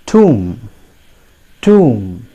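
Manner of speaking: "tomb" as "toom", silent b; drawn-out "tomb", ending on a hummed m; correct pronunciation. Shown as a demonstration. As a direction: model "tomb" with long oo, falling. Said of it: "it is pronounced as".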